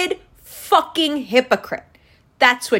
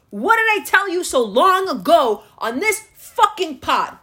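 normal talking voice

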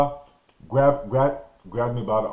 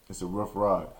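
a much lower, softer repeat of "It's a rough ride"; trailing off; 6 semitones lower, 7 dB quieter than usual. Real, not acted. Recalling the long car ride home, a low dropped-away repeat. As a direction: let the repeat sink under your breath.

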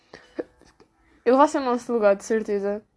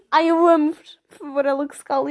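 mock gushing; 3 semitones higher, 4 dB louder than usual. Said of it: "E eu fico tipo".

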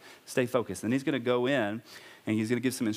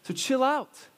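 Paul scolding; 9 semitones higher than usual. Retold or imitated. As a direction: voice Paul telling the quarreling men off. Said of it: "And Paul is telling them".

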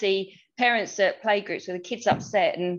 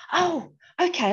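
put-on high, hesitant little boy agreeing; lightly voiced toddler settling down; small child voice; 9 semitones higher than usual. Mother acting out son calming.

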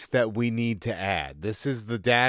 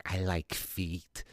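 creepy guy voice; 4 semitones lower, 9 dB quieter, much slower than usual. Acted. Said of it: "a pervert in America is just like".

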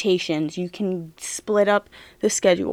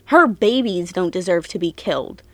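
usual speaking voice

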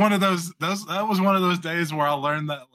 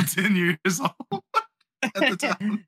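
laughing; 5 semitones higher, slower than usual. Real, not acted. Laughs at his own confession.

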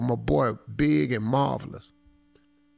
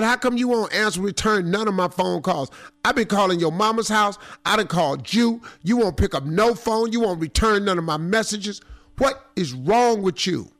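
mock scolding ex; 6 semitones higher than usual. Ex scolding him at bar.

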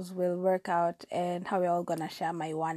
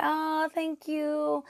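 awkward thanks; 9 semitones higher, much slower than usual. Retold or imitated. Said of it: "I'm just like".

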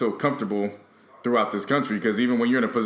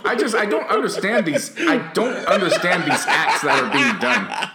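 laughing disbelief; 5 semitones higher, 3 dB louder than usual. Real, not acted.

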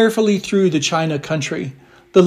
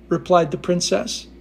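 usual speaking voice

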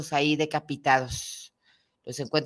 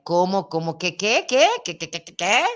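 mock disbelief; 4 dB louder than usual. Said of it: "Como que no escuché".

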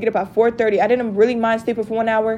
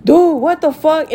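higher, slow mock yell; imitated exasperated yelling; 5 semitones higher, 4 dB louder, slower than usual. Him yelling before hanging up on her, imitated exasperated yell. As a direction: stretch out his fed-up outburst. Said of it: "literally yelling, like".

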